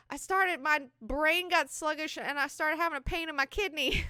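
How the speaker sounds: high, loud breathless telling; excited young man. Retold or imitated. Young man telling Jessica what aging felt like, high and loud. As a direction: gush it out, still amazed.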